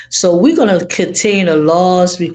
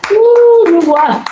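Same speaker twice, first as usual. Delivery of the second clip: a high, loud delighted ooh; excited squeal; 6 dB louder, slower than usual. Real, not acted. Thrilled by viewer's answer.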